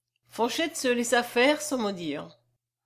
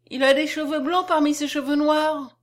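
put-on higher, louder childlike wonder; astonished little girl; 6 semitones higher, 5 dB louder than usual. A narrator's reading. Little girl remarking on the dead man's hair, astonished. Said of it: "reprit la fillette avec étonnement".

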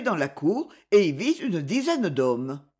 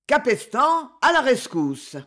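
raised, higher-pitched rallying cry; hoarse battle cry; 5 semitones higher, 4 dB louder than usual. A narrator's reading. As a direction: cry out like a swordsman charging in. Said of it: "d'une voix rauque, il cria". (cri de guerre rauque)

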